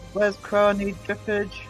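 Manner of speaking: ordinary delivery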